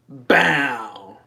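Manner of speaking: a short punchy mock Kanye yelp; [name]. Streamer's micro impression for chat, punchy mock yelp. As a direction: bark it like the [name] ad-lib. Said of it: "I can do an impression of [name]".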